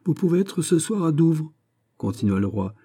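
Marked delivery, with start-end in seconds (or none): 0.1-1.5 high-pitched royal dismissal; brisk king. Acted. King sending the viscount to Dover, brisk. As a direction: announce the plan in a raised kingly voice.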